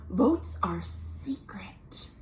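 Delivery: soft slow secret whisper; hushed playful whisper. Shown as a demonstration. Rule about secret votes.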